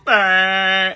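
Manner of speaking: very high, loud mock wail; imitated wail. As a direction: let it burst out as a loud cry. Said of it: "he let out this noise".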